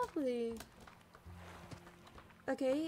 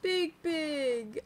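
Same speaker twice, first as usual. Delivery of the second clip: louder cry of delight, a little tearful; excited big big; 3 semitones higher, 7 dB louder than usual. Real, not acted. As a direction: gush over the giant creature. Huge creature appears.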